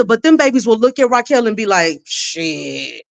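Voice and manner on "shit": very low, hushed scoff; mock a kid's under-the-breath brush-off; low, soft, drawn-out dismissal; dismissive kid. Kids' defiant reply, voiced.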